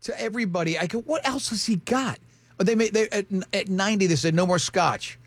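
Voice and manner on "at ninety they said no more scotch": ordinary delivery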